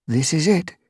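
high, tense little gray cat; tense reply. A narrator's reading. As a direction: answer tightly and nervously, pitched high. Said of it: "replied tensely".

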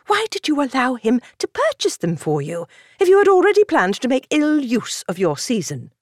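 put-on cold, scolding older lady; frosty disapproval. A narrator's reading. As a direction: scold her frostily as the countess. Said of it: "Disapproval frosted the older woman's voice".

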